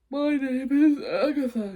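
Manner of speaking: blocked-nose monster voice; stuffy, snuffly slime monster; stuffed nose. Acted. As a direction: say it with a head cold. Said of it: "she said through a stuffed nose".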